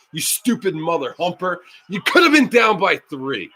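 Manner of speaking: ordinary talk, nothing marked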